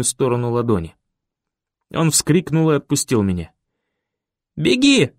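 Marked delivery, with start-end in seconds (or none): 4.6-5.1 much higher, louder shout; urgent shout. A narrator's reading. Boy yelling at Ben to escape, urgent shout. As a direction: yell at him to run. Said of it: "бросил я Бену".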